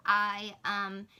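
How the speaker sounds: ordinary delivery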